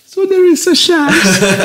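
very high, drawn-out mock elation; hammed-up high, loud hopeful cry; dim lovestruck fool. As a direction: squeal it slowly, like a film idiot clinging to hope. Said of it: "Dum Dummare när han är kär".

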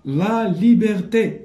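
ليبرتي slow and high, syllable by syllable; stretched dictation of ليبرتي. Shown as a demonstration. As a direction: sound out li-ber-TÉ emphatically. Teacher dictates word.

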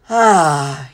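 low, full sighed ah; voiced sigh easing down; relieved sigh. A narrator's reading. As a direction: let out a contented sigh. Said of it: "he sighed".

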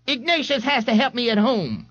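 high, fretful mother's voice; Mrs Riley. Acted. Mrs Riley excuses Ignatius to the policeman, high and fretful. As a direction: make excuses for her grown son.

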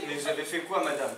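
ordinary talk

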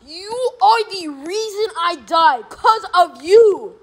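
high, slow, drawn-out threat; indignant blame. Real, not acted. Player blaming another for their death, indignant. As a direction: stretch each word in high outrage.

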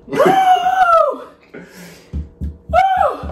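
loud high whoop from the burn; high woo. Real, not acted. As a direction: whoop at the heat. Reacting to spicy noodles.

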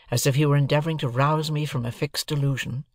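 ordinary delivery, nothing marked

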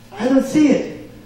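ordinary talk, nothing marked

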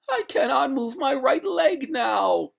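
high, loud, slow wail; despairing ghost. A narrator's reading. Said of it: "cried the ghost in despair".

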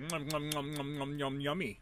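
soft munching noises in words; playful munching. Pretend. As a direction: pretend to gobble it up, quietly. Playful pretend eating.

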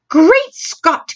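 loud, high exclamation of shock; shocked cry. A narrator's reading. Queen seeing the newspaper headlines, shocked cry. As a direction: burst out in astonishment. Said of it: "cried the famous voice".

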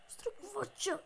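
sneaky high whisper. Real, not acted. Gamer whispering his sneaky plan to viewers.

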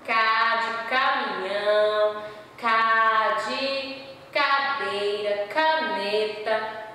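slow, drawn-out syllables, K de caminhão; slow sounding out. Shown as a demonstration. Teacher sounding out K words slowly for the class. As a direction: stretch each K sound for the children. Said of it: "Como é que eu escrevo K?".

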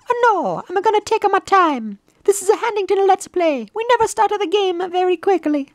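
high-pitched, stubborn character voice; unhurried cartoon hero. Hero telling messenger he'll take his time, high voice.

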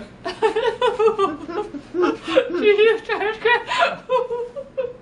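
helpless squealing laughter; high laughing fit. Real, not acted. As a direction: laugh until crying. Laughing at the game.

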